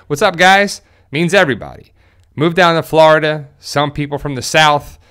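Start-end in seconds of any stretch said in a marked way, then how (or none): 0.1-0.8 "What's up, guys?" high, loud, New Jersey style; plain Jersey "What's up, guys?" with no twang. Shown as a demonstration. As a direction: call out "guys" the way people do back in Jersey. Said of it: "you kinda say".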